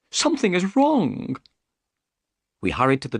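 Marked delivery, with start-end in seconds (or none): 0.1-1.3 nervous man's voice, raised high; worried host puzzled by the dark house; anxious Thaddeus. A narrator's reading.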